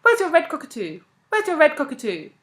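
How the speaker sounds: high, loud, insistent demand; sudden outburst. A narrator's reading. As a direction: burst out as if accusing a friend.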